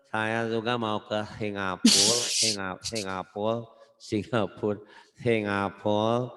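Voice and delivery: "Singapura" with the s lisped as th; babyish, tongue-between-teeth "Singapura"; spoiled, lazy-tongued lisp. Shown as a demonstration. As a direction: say "saya juga mau ke Singapura" like a pampered child. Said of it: "kayak orang manja gitu deh".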